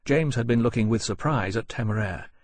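usual speaking voice

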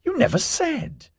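very high-pitched astonishment; surprised. A narrator's reading. Ozzy to Melanie, who hid her engagement, surprised. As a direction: let the pitch leap in surprise. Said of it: "face screwed up into surprise".

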